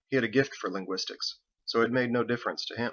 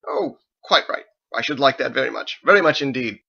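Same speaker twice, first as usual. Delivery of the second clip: delighted pompous cleric; 3 semitones higher, 7 dB louder than usual. A narrator's reading. Thomas accepting Michael's seat offer, loud pompous delight. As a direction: gush with prim delight. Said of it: "impressive barrage of gargling diction".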